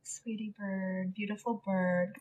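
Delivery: slow, soft, soothing murmur; tender cooing. Real, not acted. Owner soothing her beloved bird, tender cooing. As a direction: soothe a pet with quiet affection.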